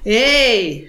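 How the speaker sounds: loud croaky raven call; raven's shout. Acted. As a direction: yank his attention with a sharp cry. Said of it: "schrie Jakob Krakel".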